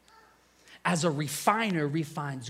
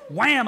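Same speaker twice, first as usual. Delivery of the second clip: loud mock slam sound, higher; wham; 9 semitones higher, 11 dB louder than usual. Preacher telling the church about falling, loud wham. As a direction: shout the crash as you hit the floor.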